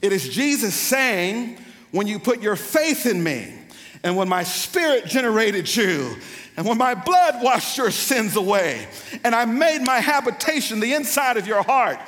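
loud, high, building sermon climax; full-force preaching. Real, not acted. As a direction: drive it up to a fervent peak.

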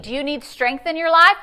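usual speaking voice

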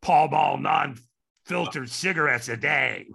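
raspy chain-smoker voice; Madge's raspy voice. Retold or imitated. Still imitating raspy Madge to the host. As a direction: keep the smoky rasp going. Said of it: "she had this really raspy voice".

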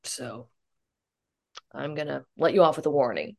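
low, hushed officer voice; confiding policeman. Retold or imitated. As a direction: imitate a cop softly owning up. Policeman confiding own teenage crash.

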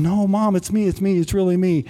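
high, insistent pleading; acting out a son begging his mother to believe; retold urgent plea, pitched well up.

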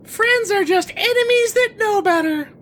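loud, high snowman voice; high character voice. Acted. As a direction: pitch it up, big and loud.

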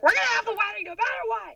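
high determined insistence; defiant. Retold or imitated. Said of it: "And I was like".

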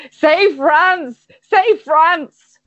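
high, urgent demand; crazed tramp. Acted. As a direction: cry it out as a tormented tramp. Voicing the tormented tramp demanding words.

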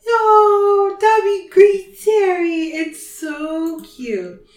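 high, loud squeal of delight, drawn out; gushing. Real, not acted. Telling co-host about Dobby's cute scene, gushing. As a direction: coo it, slow and sing-song at the end.

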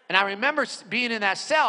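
ordinary delivery

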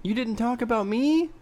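high, wounded rising question; butthurt. Real, not acted. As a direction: sound offended at being left out. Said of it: "Sounds so butthurt about that".

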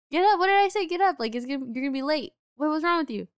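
high, loud imitation of a scolding father; mock-scold your kid for making you late; impatient dad. Retold dad scolding late child.

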